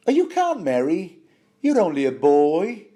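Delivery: high, loud motherly protest in a badly faked northern accent; attempted northern accent. Podcaster voicing Catelyn scolding her son, attempted northern accent. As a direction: attempt a northern English accent, pitched right up. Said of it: "I can't do a proper northern accent".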